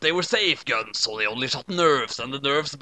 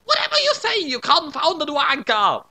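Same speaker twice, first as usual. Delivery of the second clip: panicked; 12 semitones higher than usual. A narrator's reading. Watery at laser point, panicking. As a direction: squeal it in terror at gunpoint. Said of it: "Watery panicked".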